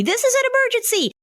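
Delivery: a high, panicked cry of alarm; alarmed slogan. Pretend. Anxiety's t-shirt, read as a high, panicked alarm. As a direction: sound like everything is on fire.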